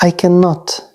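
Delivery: ordinary delivery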